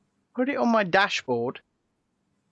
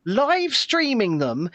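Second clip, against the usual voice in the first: ordinary talk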